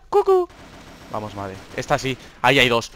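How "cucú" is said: high teasing squeal; peekaboo squeal. Real, not acted. Gamer teasing enemy, high peekaboo squeal. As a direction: pop out like a peekaboo.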